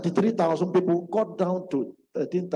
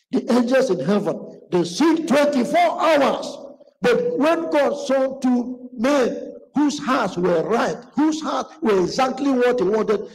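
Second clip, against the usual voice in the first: fervent preaching; 6 semitones higher, 5 dB louder than usual. Acted. Preacher proclaiming God's order to the heavenly choir. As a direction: build it up with awe.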